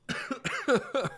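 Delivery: lingering choking cough; clearing water from the throat. Real, not acted. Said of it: "Hang on a second".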